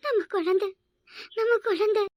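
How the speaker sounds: put-on, broken-hearted crying; tearful. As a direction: break the news in sobbed fragments. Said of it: "மாறி மாறி அழுறீங்க". (விம்மி அழும் குரல்)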